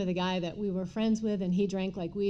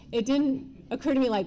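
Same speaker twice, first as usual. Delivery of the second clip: ordinary delivery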